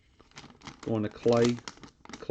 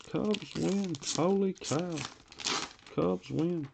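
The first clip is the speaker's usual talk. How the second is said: Freddie Freeman call; 7 semitones higher, 3 dB quieter, slower than usual. Retold or imitated. Mock announcer call repeated during the card break. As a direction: replay the announcer bit to himself, pitched high. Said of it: "That's what he's screaming".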